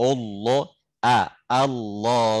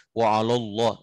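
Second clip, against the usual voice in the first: usual speaking voice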